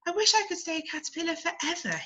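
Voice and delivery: a shy little caterpillar wishing; tiny caterpillar. Acted. Small caterpillar wishes.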